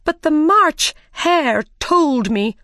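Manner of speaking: high, loud, drawn-out protest; pleading excuse. A narrator's reading. Mad Hatter blaming the March Hare, pleading. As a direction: shift the blame in a high, slow wail. Frightened Hatter shifts the blame.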